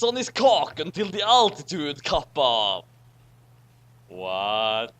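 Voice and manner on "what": usual speaking voice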